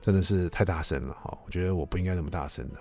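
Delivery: gentle apology. Shown as a demonstration. Husband demonstrating to the kids how to apologize to his wife, softly and tenderly. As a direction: say sorry softly to calm her. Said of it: "你要很温柔的说".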